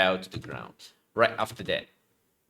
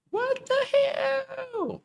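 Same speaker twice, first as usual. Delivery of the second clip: shrill startled squeal, slowed; strained high-pitched shriek; much slower than usual. Acted. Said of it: "Cannot make high voice".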